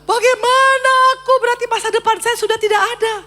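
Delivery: remembered sobbing lament; weeping despair. Retold or imitated. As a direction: cry out with no hope left. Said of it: "Saya nangis, saya bilang".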